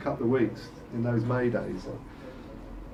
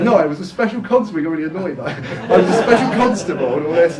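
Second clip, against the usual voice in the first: indignant retort; 5 semitones higher, 8 dB louder than usual. Retold or imitated. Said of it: "He said".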